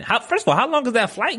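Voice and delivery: high-pitched, incredulous question. Real, not acted. Host asking guest about the flight to Australia, incredulous. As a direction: demand an answer in amused disbelief.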